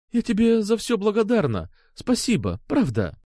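tearful thanks, high at first then dropping. A narrator's reading. Алёна thanks Сергей as a goodbye, tearful. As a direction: thank him through swallowed tears. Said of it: "глотая слёзы".

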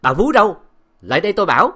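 high, loud summons; loud shout. A narrator's reading. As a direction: yell for the maid. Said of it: "Tiếng Bá Nam hét to".

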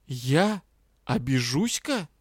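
a baffled echo; surprised. A narrator's reading. Rian echoes his bride's strange word, surprised. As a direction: repeat it in disbelief. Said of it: "удивлённо переспросил Риан".

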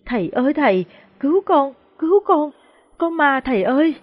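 high, frightened cries for help; sobbing. A narrator's reading. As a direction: beg to be saved, pitch leaping up in panic. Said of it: "ôm chầm lấy thầy Sô khóc rưng rức". (khóc rưng rức, hoảng hốt kêu cứu)

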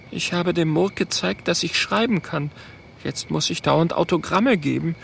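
high, subdued small voice; little dragon Oskar, hushed. Acted. Oskar replying to Mathilda at night, hushed.

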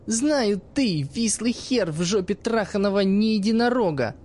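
put-on the girl angrily cursing her teacher; angry girl's curse. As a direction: spit the insult back at the old man.

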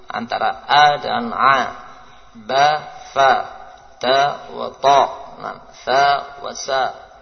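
each letter "ba", "fa", "ta", "sa" sounded distinctly and loud; contrasting letter sounds. Shown as a demonstration. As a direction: make every letter clearly different. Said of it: "usahakan betul-betul beda". (bedakan bunyi huruf dengan jelas)

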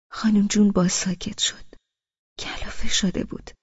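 narration dropped to a whisper; hushed, breathy aside, as if the room went silent. A narrator's reading. Narrator hushes as the grandmother falls silent.

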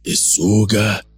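slow, drawn-out demonic tempter; devil king. Acted. Devil king presses Adam to choose, slow and commanding. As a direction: stretch each phrase out ominously.

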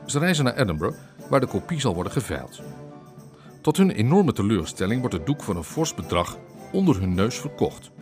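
normal talking voice

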